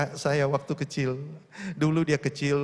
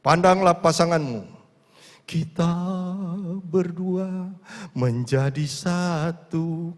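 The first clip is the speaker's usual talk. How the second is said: priest's instruction; 3 semitones higher, slower than usual. Retold or imitated. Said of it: "romonya bilang".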